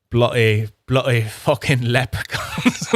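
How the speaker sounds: put-on a half-hearted, laughing stab at an Irish accent; a quick mock Irish accent, slow and giggling. Host jokes about leprechauns, a lightly laughing mock Irish accent. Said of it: "try to do an Irish accent".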